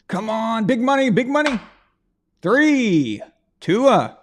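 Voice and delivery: high, hyped game show host. Pretend. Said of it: "game show voice".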